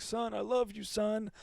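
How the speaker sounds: high soft mock deathbed plea; a lightly hammed dying father; Hank's movie farmer saying goodbye to his son, soft and high. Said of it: "He's like".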